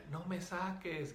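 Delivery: high, drawn-out mock cry of a child; little girl's voice. Possessed student pleads with the healer, mock little-girl yell. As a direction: imitate a little girl yelling without real volume. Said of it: "comenzó a gritar con una voz de niña".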